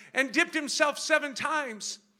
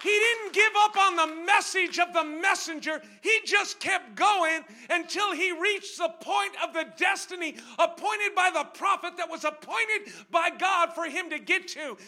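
loud, high shouted sermon climax; preaching at full force; 4 semitones higher, 10 dB louder than usual. Acted. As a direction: drive it home to the congregation.

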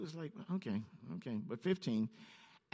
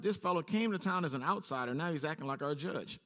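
ordinary talk

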